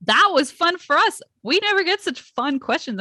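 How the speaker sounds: high, bright thanks; enthused. Real, not acted.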